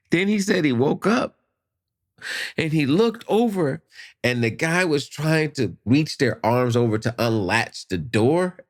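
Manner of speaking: ordinary talk, nothing marked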